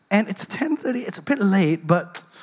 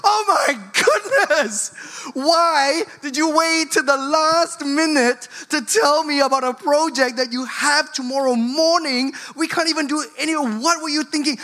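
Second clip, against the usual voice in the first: exasperated parent; 7 semitones higher than usual. Retold or imitated. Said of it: "And then you go".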